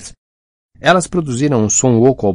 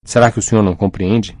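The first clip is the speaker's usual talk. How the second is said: plain reading voice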